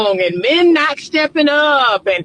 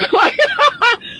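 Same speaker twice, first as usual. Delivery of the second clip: high squealing laugh; burst of laughter; 11 semitones higher than usual. Real, not acted. Vlogger laughing at her own joke, squealing laugh.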